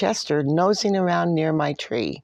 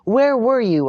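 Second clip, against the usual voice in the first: loud, higher worried yell; 7 semitones higher, 6 dB louder than usual. Acted. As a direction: yell at the dog who vanished. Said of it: "I yelled".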